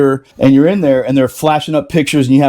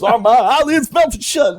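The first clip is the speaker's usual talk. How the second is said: hammed-up cry of a burned man; wailing blinded corporal; 9 semitones higher, slower than usual. Retelling the story for the old veterans, high slow wail. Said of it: "He goes".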